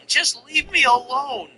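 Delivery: high, drawn-out fake crying; mock tearful plea. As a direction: imitate someone pleading on the verge of tears. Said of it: "literally on the verge of tears, crying".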